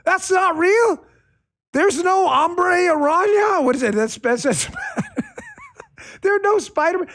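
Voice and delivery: high, laughing mock disbelief; mocking gullible liberal. Mocking liberals who think Spider-Man is real, high laughing disbelief. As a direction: play a naive fan shocked that it's fake. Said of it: "liberals out here who are like".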